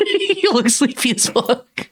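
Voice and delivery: slow, high, talking through laughter; real laughing. Real, not acted. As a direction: crack up while teasing a friend. Cracking up at sleepy co-host.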